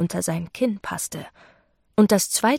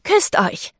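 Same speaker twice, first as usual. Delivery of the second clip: high, excited command; enthusiastic call; 8 semitones higher, 4 dB louder than usual. A narrator's reading. Photographer urges the posing couple to kiss, enthusiastic call. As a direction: call it out as a gleeful order. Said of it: "rief Ruby begeistert".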